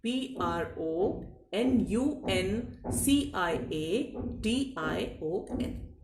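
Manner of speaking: letters spelt slowly one by one; spelling out. Shown as a demonstration. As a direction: dictate each letter slowly. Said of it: "इसकी spelling है".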